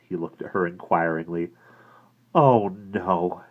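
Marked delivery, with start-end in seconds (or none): 2.3-3.4 voice shaking. A narrator's reading. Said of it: "Her voice shook a little".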